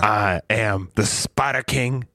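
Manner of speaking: slow, drawn-out mock introduction; slow, ceremonious delivery; dragged out. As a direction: stretch every word out for really long. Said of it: "You have to drag it out for really long".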